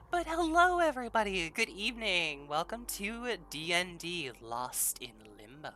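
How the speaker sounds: high, loud announcer welcome; show host intro. Acted.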